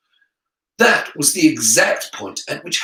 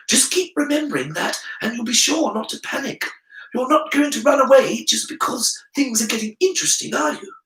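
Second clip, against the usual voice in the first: pitched-up inner voice, urging; bold Gryffindor side; 9 semitones higher than usual. Acted. Harry's Gryffindor side urging him not to run, high and urging.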